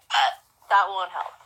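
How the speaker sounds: ordinary delivery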